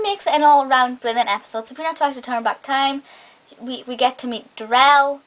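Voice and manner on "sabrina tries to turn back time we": ordinary talk, nothing marked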